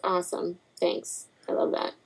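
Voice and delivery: very quiet, unimpressed resignation; muted mock gratitude; sarcastic. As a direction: give in softly with no enthusiasm. Sarcastic reply to name choice.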